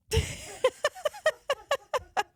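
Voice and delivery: a high, bubbling burst of laughter; real laugh. Real, not acted. Host cracking herself up on her podcast, high bubbly laugh. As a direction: laugh at your own silly comparison.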